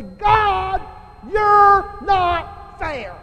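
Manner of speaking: slow, high, loud accusing cry; shouted complaint to God. Retold or imitated. Preacher voicing backslidden people complaining to God, shouting. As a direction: hurl each word up at God, drawn out. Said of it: "what did the people say?".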